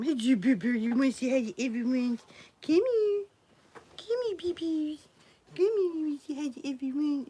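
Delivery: high, cooing dog-owner voice. Real, not acted. Owner invites dog to say hi, baby talk. Talking to pet dog on stream.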